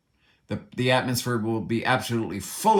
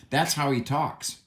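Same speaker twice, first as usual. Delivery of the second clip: ordinary talk, nothing marked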